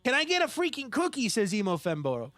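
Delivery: hammed-up Boston accent, big and brash; bad Boston accent. Streamer answers a viewer wanting a cookie, bad Boston accent. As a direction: put on a cartoonish Boston accent. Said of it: "in a really bad Boston accent".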